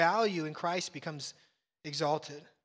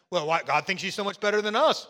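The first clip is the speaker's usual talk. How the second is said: mock grumble; 8 dB louder than usual. Imitating a complaining person.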